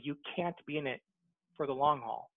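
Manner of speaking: usual speaking voice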